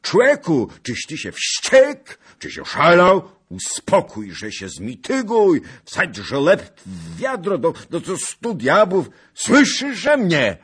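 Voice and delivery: very high, very loud panicked cries; frantic yelling. Acted. Zagloba yelling at the raging Bohun, frantic. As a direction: shout in terror to stop him. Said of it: "i krzyczał".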